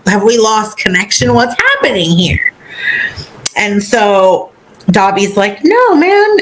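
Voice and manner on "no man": high-pitched mock protest; alarmed protest. Dobby stopping Harry mid-claim, alarmed high protest. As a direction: protest as a flustered house-elf, voice jumping up.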